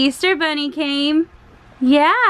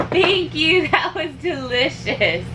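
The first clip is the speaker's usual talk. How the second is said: ordinary delivery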